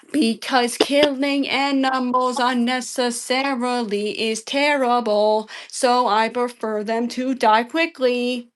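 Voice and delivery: slow and spelled out, word by word; exasperated slow-motion explanation; slow, deliberate. Shown as a demonstration. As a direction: say it slowly, like to someone who won't get it. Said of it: "do I have to say it slower next time".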